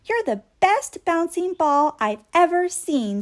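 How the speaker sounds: a toy admiring the bouncy ball; Stuffy voiced bright. A narrator's reading. Stuffy greets Boomer, lightly voiced toy.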